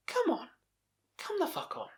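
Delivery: very high exasperated come on; high-pitched fake outrage; incredulous squeal. Real, not acted. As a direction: squeal it in disbelief. Mock outrage at the scene.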